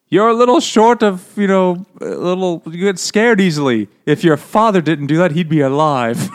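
hammed-up tactless aristocrat; tactless toff. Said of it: "says something like".

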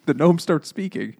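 usual speaking voice

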